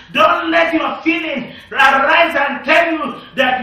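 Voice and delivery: loud, rising shouted preaching; fiery pulpit shout; preaching at full force. Real, not acted. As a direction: drive it home to the congregation. Preacher builds to a shouting climax.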